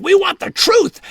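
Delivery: high, loud interrogator's demand. Retold or imitated. Reporters grilling him in a back room, loud demand.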